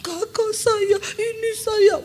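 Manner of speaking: high, choked sobbing words; imitating a weeping woman; crying. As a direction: sob it out through tears. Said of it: "Dia mulai cerita".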